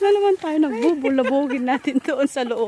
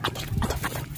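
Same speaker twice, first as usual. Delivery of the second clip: whispering while hiding; 10 dB quieter, faster than usual. Real, not acted. Target spotted, hiding.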